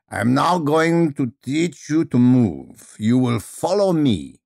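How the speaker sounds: slow, commanding Russian teacher; Madame Fedra, rich Russian accent. Acted. Eccentric teacher instructing her movement class, rich Russian accent. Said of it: "speaking with rich Russian vowels".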